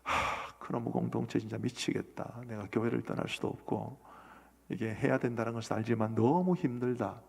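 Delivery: sighing, softly imitated complaint; weary wife. Wife complains to pastor after church, imitated weary and soft. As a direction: retell her complaint with a sigh, quietly. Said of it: "저한테 그러더라고요".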